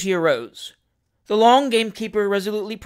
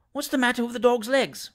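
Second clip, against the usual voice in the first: usual speaking voice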